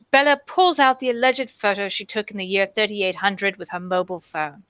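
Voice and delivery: ordinary talk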